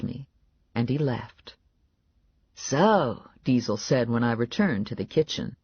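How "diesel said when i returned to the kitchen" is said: plain reading voice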